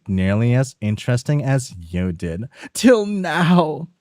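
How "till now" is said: higher and fast, urgent; a hurried, nervous accomplice pushing to act; brisk high character voice. Acted. A nervous partner urging the other to deal with the body, high and fast.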